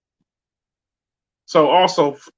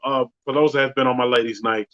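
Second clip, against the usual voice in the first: normal talking voice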